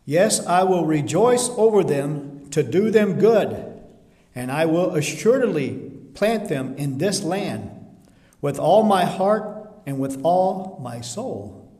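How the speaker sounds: loud and slow scripture proclamation; slow, emphatic preaching. Acted. Preacher proclaiming God's promise slowly to the church. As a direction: dwell on each promise with full force.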